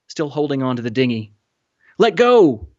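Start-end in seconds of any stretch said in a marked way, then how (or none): 2.0-2.7 high, loud urgent order; yelling. A narrator's reading. Woman in the dinghy to boy hanging on, yelling. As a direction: yell it down at someone, pitched high. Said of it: "a woman in the dinghy yelled down at him".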